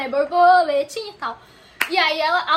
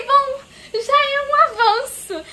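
slow, high, laughing through the words; 7 semitones higher, slower than usual. Real, not acted. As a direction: chuckle it out in relief. Laughing relief at small progress.

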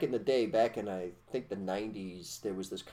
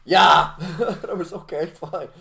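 high, loud, laughing tease; 8 semitones higher, 10 dB louder than usual. Real, not acted. Teasing his co-host about France while laughing hard. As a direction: talk through helpless laughter, pitched way up.

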